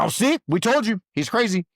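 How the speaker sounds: high-pitched mocking told-you-so; gloating accusers. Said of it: "when I finally get upset, they're like".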